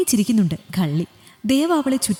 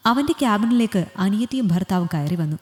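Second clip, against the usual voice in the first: usual speaking voice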